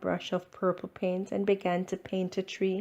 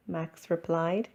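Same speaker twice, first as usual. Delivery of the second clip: plain reading voice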